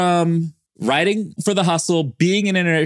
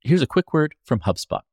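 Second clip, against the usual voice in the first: smooth, measured sponsor read; 5 semitones lower than usual. Acted. Host reads a sponsor message, ad voice. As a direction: read the ad like a radio spot.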